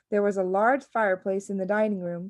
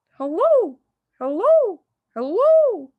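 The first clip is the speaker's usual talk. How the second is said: parrot calling; 13 semitones higher, 9 dB louder, slower than usual. Acted.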